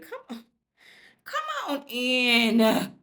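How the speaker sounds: high, slow, drawn-out come-on; mock seductive invite. Mock flirting with the character.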